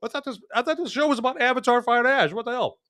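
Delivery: lightly voiced confused viewer. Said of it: "anybody that's watching the show going".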